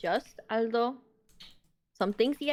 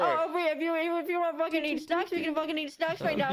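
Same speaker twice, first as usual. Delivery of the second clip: mock exasperated rant; 5 semitones higher, faster than usual. Scolding a friend eating in meeting.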